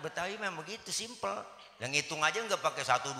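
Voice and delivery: normal talking voice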